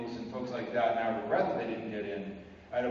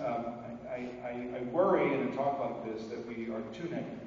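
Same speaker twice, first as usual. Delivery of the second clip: usual speaking voice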